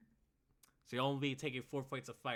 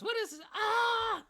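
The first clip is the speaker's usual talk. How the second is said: very high, loud goblin shriek; shrieking panicked squeal, far above your usual voice; 14 dB louder, slower than usual. Acted. Game master voices a burning goblin to the players, high shrieking goblin. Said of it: "this goblin just goes".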